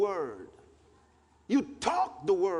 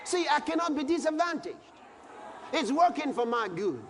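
preaching at full force; 6 semitones higher than usual. Acted. Preacher proclaiming scripture to the congregation, full force. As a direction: shout it out to the church.

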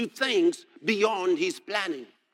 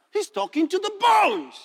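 loud, high-pitched preacher's cry; amazed exclamation; 11 semitones higher, 5 dB louder than usual. Real, not acted. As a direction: cry it out in astonishment.

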